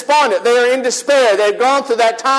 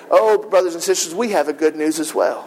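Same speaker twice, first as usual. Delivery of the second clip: normal talking voice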